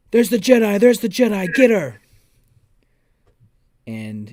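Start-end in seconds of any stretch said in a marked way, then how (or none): 0.1-2.0 loud yelling troopers' call. Acted. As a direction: shout it across the hangar. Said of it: "they start yelling".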